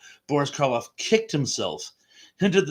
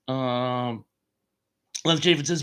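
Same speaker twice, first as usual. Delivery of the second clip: normal talking voice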